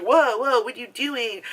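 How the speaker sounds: high alarmed whoa, whoa; mock-shocked brother catching his sister; startled protest. Brother walking in on her, startled. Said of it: "And he's like".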